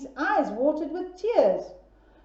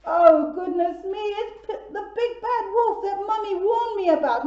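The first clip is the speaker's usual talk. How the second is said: mock fright; 7 semitones higher, 5 dB louder than usual. Piggy sees the wolf.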